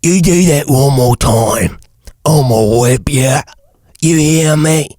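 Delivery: slow, rough smoker's growl; grungy, drawn-out alien overseer voice; slave master. Acted. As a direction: threaten like a harsh slave master. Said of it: "it's more of a slave master".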